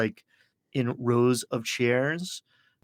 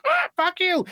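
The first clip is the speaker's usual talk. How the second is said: high, squawking parrot curse; angry bird; 4 dB louder than usual. Acted. Parrot knocked off a shoulder, squawked curse. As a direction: squawk it in a much higher put-on voice. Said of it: "and the bird goes".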